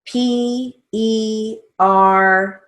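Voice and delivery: slow, deliberate dictation read with pauses. Shown as a demonstration. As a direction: dictate each word slowly and clearly for the class. Dictation with spelled name.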